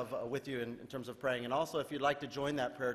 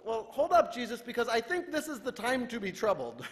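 mock objection; 7 semitones higher than usual. Preacher playfully objects to Jesus, higher mock protest. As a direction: push back with mock disbelief.

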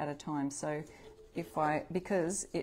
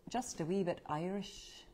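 first try at an Irish lilt on "Just a Wee Bit Irish"; lightly lilting Irish accent, softer; 4 dB quieter than usual. Shown as a demonstration. A crafter reading a stencil to viewers in a mock Irish accent. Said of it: "There's a better accent".

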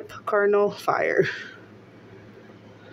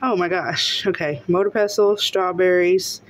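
ordinary talk

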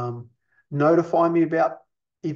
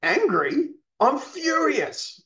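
indignant correction; 10 semitones higher, slower than usual. Acted. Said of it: "He might say".

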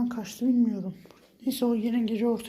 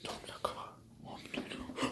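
hushed, breathless whisper so it won't hear; whisper, hiding from the creature; tense whisper while hiding; scared whisper; 14 dB quieter, faster than usual. Real, not acted. Hiding from the monster in game.

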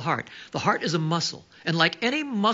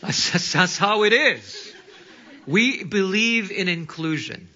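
laughing, louder, slow punchline; amused laughing aside; 5 semitones higher, 5 dB louder than usual. Real, not acted. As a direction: land the joke through laughter. Joking about class reunions.

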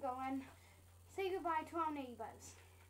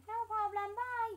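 high, louder put-on voice; chirpy second friend; 7 semitones higher, 5 dB louder than usual. Friend answering thanks and goodbye, high chirpy voice. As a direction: pipe it up high and loud.

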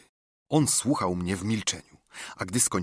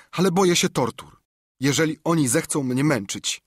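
tearful pleading; 5 semitones higher, 4 dB louder than usual. A narrator's reading. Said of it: "zacząłem płakać".

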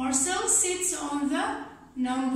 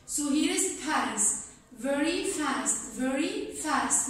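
ordinary delivery

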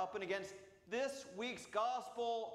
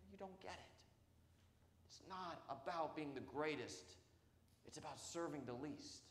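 much softer, lower correction; quiet rebuke; 5 semitones lower, 9 dB quieter than usual. Acted. Jesus quietly correcting disciples who argue over greatness. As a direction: say it gently, almost to yourself.

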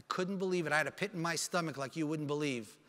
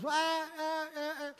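high wail tailing off softer; imitated wailing; 8 semitones higher, 3 dB quieter, slower than usual. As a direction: mimic a distraught mother's cries over the phone. Mother's reaction to news, imitated.

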